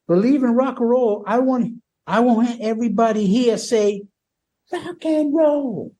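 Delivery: mocking the frontman leading a crowd chant. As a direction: stretch rock and roll like a frontman. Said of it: "this is what Paul says".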